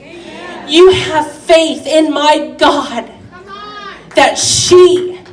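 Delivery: loud, fervent preaching. Acted. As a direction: build it like a revival sermon. Preaching at full force.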